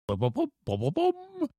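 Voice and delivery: soft lip-popped trumpet tucket, leaping high; mouth-trumpet fanfare. A narrator's reading. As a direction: mimic a little fanfare with your lips. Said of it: "mimicked a trumpet tucket with his lips".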